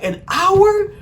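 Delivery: sharp high "an hour?" in mock outrage; imitating her high, shocked yelp; shocked disbelief. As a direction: squeak it like she can't believe it. Girl shocked at an hour or two.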